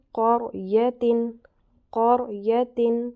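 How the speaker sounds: قریتن drawn out very slowly, with a heavy ر; slow Quran recitation. Shown as a demonstration. Teacher demonstrating the heavy ر to students, slow recitation. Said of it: "را کو میں نے موٹا پڑھا".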